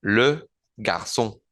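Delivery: le garçon slowly, le with rounded E, ç as S; slow French demo. Shown as a demonstration. Teacher slowly modelling le garçon for the class. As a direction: stretch out le garçon syllable by syllable. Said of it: "que suena como una S".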